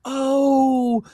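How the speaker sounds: high, drawn-out cooing in mockery; mock patronizing delight. Viewer cooing over someone they look down on, mock delight. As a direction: gush with exaggerated condescending sympathy. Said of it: "you can just go like".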